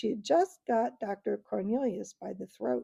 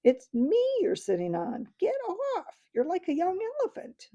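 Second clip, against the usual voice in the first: much higher, indignant and fast; angry voice; 10 semitones higher than usual. Acted. Dwarf complains from under the boy, high and indignant. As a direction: squeal it in outrage. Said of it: "came an angry voice".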